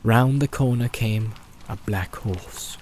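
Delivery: usual speaking voice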